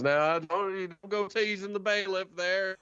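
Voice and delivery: high, folksy drawling prisoner; chatty, smug old codger; Jorge voice. Acted. Jorge explains the prisoners' departure, high and folksy. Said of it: "And he goes".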